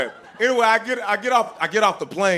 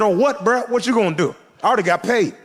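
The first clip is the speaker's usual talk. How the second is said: cocky comeback; 7 semitones higher than usual. Retold or imitated. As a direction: brush him off with swagger.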